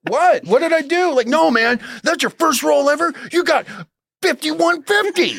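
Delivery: high, excited mock rant; imitating frantic guy. Imitating the crackhead raving about the dice roll, high and excited. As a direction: ham up a frantic stranger. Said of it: "retelling crackhead's outburst".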